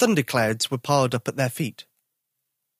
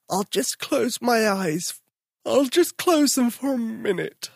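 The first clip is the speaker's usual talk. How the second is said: sleepy; 9 semitones higher, slower than usual. Acted. Sleepy Tom yawning.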